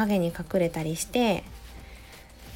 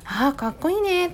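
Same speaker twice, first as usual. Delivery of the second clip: teacher's praise; 9 semitones higher than usual. Retold or imitated.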